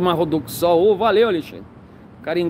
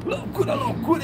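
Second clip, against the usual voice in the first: shouted catchphrase; 8 semitones higher, 5 dB quieter than usual. Real, not acted.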